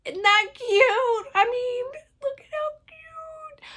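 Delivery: very high, squeaky coo over something cute; gushing. Real, not acted. Vlogger showing viewers a cute pattern, high-pitched gushing. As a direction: go sky-high, fading to a tiny squeal.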